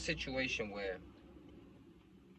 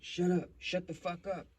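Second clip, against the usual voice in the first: higher-pitched imitation of dad's urgent scolding; urgent hushing dad; 7 semitones higher than usual. Dad tells son to shut up, urgent. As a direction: act out a father urgently silencing his kid.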